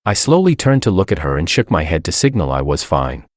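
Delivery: plain reading voice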